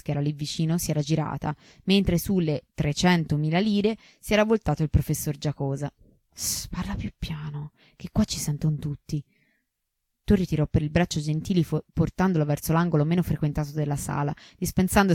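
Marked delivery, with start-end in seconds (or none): 6.3-9.2 hushed whisper, urging him to keep it down; whispered warning. Acted. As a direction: whisper so no one overhears. Sussurro per non farsi sentire. (sussurro di avvertimento)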